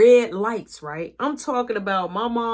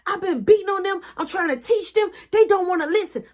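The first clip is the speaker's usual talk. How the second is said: ranting mom; 9 semitones higher than usual. Retold or imitated. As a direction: vent it, fed up.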